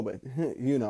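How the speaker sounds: ordinary talk, nothing marked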